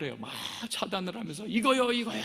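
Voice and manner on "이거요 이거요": higher, louder pushy insistence; praying person demanding their own wish; insistent pleading. Retold or imitated. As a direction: grab at what you want. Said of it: "막 이러면서".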